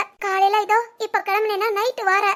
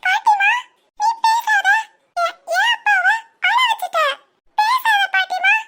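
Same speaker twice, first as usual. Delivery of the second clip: tearful scolding; 11 semitones higher, 3 dB louder, slower than usual. Acted. Child scolds grandma for upsetting father.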